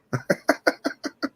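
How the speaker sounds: real breathy, voiceless laugh. Real, not acted. Woman remembering her grandma's joke, breathy laughing. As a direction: crack up in a string of quick chuckles. Cracks up at own grandma story.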